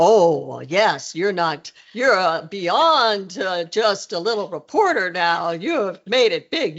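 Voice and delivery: grandly drawn-out mock praise; imitating the president. President teasing a reporter who made it big, imitated grandly. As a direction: stretch it out with swooping emphasis. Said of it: "and he said".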